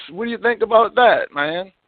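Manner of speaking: usual speaking voice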